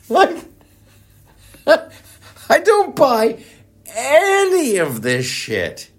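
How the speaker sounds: high and slow through a laugh. Real, not acted. Commentator laughs off the government's excuse, high and slow. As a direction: laugh it off in disbelief.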